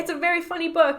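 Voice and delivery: high, loud, quick burst; excited, emphatic correction; emphatic insistence. Real, not acted. Insisting after calling it sad.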